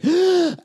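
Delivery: big high gasp, imitated; child's pre-scream gasp. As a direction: suck in air like a kid about to scream. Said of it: "did that screaming that kids sometimes do of going".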